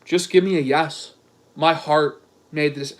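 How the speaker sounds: low mock-suitor voice; mock-solemn romantic reading; Dick. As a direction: repeat the plea low and solemn. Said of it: "Dick goes".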